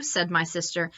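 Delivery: usual speaking voice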